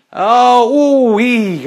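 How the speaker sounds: high, loud oohing over a shot; commentator's wordless thrill; excited reaction. Pretend. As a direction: follow the balls with rising and falling oohs.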